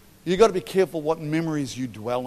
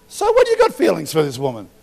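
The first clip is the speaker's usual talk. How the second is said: loud retort; 10 dB louder than usual. Retold or imitated. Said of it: "And I'm like".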